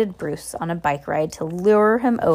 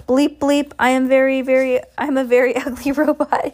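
high, loud monotone robot; 6 semitones higher, 4 dB louder than usual. Acted. Henry teasing about the ugly cake robot, in a robot voice. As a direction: bleep it out like a robot. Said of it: "he said in a robot voice".

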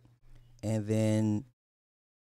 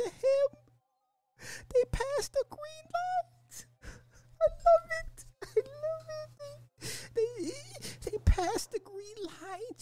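high falsetto mock cry, slowed and wavering; mock sobbing; much slower than usual. Streamer mock-weeping over a loving couple clip, high falsetto sob. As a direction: ham up tears of joy in a squeaky voice.

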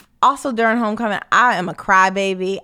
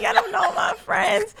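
laughing mock plea; 12 semitones higher, 5 dB quieter than usual. Retold drunk plea, laughing.

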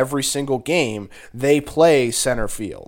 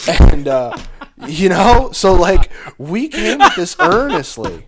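loud, high, pumped-up promo voice; wrestling promo; 6 semitones higher, 7 dB louder than usual. Real, not acted. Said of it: "are you just cutting a promo right now".

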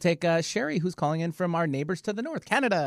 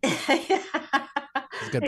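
laughing; 13 semitones higher, much slower than usual. Real, not acted. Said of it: "I like the break in between".

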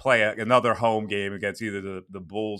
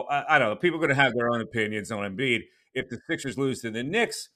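ordinary talk, nothing marked

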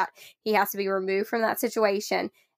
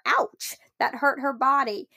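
high ouch then gentle reminder; mock ouch; 4 semitones higher than usual. Shown as a demonstration. Mother reminding toddler that hitting hurts, high ouch. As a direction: exaggerate the ouch for the child. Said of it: "And then also reminding".

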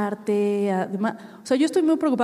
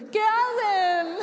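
high puzzled; 11 semitones higher, much slower than usual. Retold or imitated. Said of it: "todos con sus pañuelos".

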